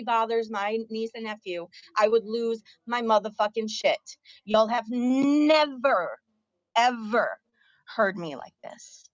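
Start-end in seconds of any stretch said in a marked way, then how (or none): 4.4-9.0 slow, emphatic fury, word by word; real anger. Real, not acted. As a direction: draw out NEVER and EVER in real anger. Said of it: "I'm mad and you've heard me mad".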